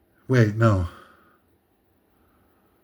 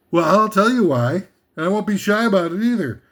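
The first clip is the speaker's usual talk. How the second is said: ordinary talk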